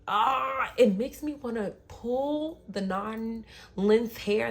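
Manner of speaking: high, loud groan into slow exasperation; frustrated. Real, not acted. Woman fed up with her past apologizing, frustrated. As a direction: burst out, then drag the words in frustration.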